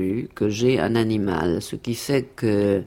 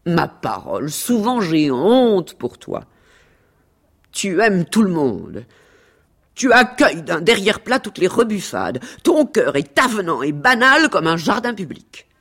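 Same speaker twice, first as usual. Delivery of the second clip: higher, emphatic reproach; scornful, declaimed rebuke; theatrical scolding; 8 semitones higher, 4 dB louder than usual. Acted. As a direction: lay into them with disdain. Cat scolds dog in dialogue.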